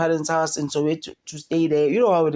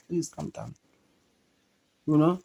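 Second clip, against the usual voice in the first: ordinary delivery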